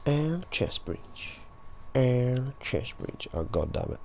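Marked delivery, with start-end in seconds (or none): 0.1-1.4 slow, drawn-out catchphrase; quoting a catchphrase. Retold or imitated. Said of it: "Quoting our chest breach again". 1.9-3.3 quoting a catchphrase. Retold or imitated. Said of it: "Quoting our chest breach again".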